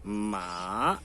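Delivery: "Ma" held low, bending up at the end; dipping third tone. Shown as a demonstration. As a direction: sink low on "Ma", then lift the tail. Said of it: "que sobe e que desce".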